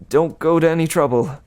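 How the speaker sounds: usual speaking voice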